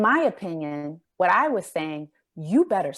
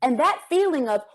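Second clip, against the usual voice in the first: ordinary talk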